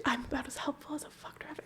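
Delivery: breathless whisper of grandma's line; whispered quote. Retold or imitated. Whispering grandmother's line to a friend. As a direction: whisper it through laughter.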